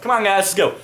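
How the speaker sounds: high, loud call to get going; rallying the guys to follow, loud and high; retold rallying call. To his party, rallying them to go, high and loud. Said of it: "And I'm like".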